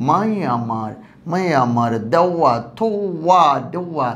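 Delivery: slow মাই আমার with y, দেওয়া with w; y and w glides. Shown as a demonstration. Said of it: "ওয়াইয়ের মতো উচ্চারণ হয়".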